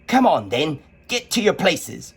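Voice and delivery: Queen ordering players, mock shout. A narrator's reading. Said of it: "shouted the Queen".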